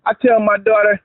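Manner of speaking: usual speaking voice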